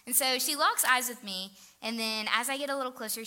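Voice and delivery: ordinary talk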